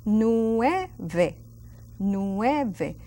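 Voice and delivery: "Nueve" stretched into careful syllables, NWEH-veh; every letter pronounced. Shown as a demonstration. Teacher pronouncing every single letter, "Nueve" carefully articulated. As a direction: articulate each sound of "Nueve", clear V. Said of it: "I will pronounce every single letter".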